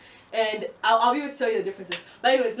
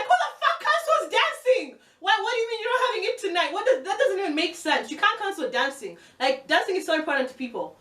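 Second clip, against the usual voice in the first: squealing disbelief, pitched way up; outraged rant; 11 semitones higher than usual. Retold or imitated. Retelling to viewers how she yelled at the bar staff, high mock outrage. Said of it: "And I was like".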